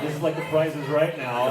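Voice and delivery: ordinary talk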